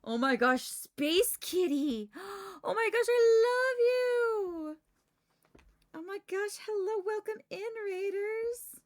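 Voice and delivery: very high, loud, squealing greeting; cute anime streamer. Real, not acted. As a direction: squeal with delight. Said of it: "greets raiders excitedly".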